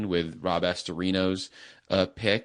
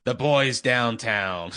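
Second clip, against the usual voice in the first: put-on slow, old-time radio announcer voice; like a vintage newsreel tough guy; old-timey radio voice; 3 semitones higher, 5 dB louder, slower than usual. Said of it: "you talk in your old-timey radio voice".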